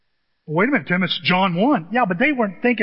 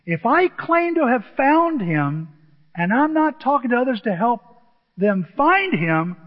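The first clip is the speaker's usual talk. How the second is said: emphatic preaching; 5 semitones higher, 4 dB louder than usual. Real, not acted. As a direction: press it home from the pulpit. Preacher drives home his point.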